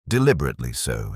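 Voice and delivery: ordinary delivery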